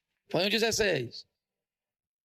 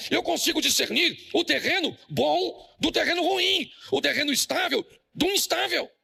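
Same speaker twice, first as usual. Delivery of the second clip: high, rousing preacher's call to the church; fiery preaching; 6 semitones higher than usual. Acted. Pastor to congregation, rousing sermon delivery. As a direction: pastor drilling the parable into the congregation, questions flung out.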